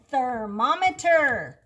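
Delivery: "Thermometer" said slowly and clearly; modeled word. Shown as a demonstration. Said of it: "Try it again".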